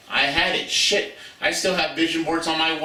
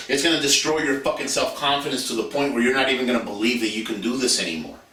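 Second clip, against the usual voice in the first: ordinary delivery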